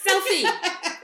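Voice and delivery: quick and loud, laughing; excited. Real, not acted. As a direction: blurt it out, laughing. Excited call to take photo.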